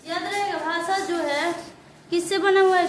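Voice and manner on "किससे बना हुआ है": loud pressing question. Real, not acted. Teacher quizzing class, pressing.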